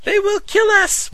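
high, slow panicked scream; terrified scream. Acted. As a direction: scream it in terror. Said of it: "one of the young girls in the group of Christians screamed".

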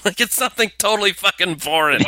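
usual speaking voice

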